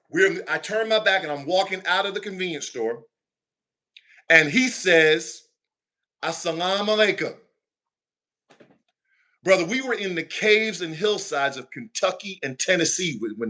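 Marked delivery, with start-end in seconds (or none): 6.2-7.4 "Assalamu alaikum" in smooth native-sounding Arabic, no Southern twang; proper Arabic greeting. Retold or imitated. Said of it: "He didn't say it with no twang, no accent. He said it like it should be said".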